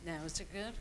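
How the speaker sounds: faint off-mic voice; speaking away from the microphone; far from mic. Real, not acted. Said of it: "Up closer".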